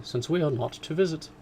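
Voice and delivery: a hint of resentment in the mother; mother's resentful retort. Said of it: "said her mother resentfully".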